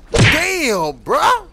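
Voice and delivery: loud, high stunned cry; high, loud groggy outburst; shocked shout. Acted. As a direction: blurt it out in shock. Waking up after knockout.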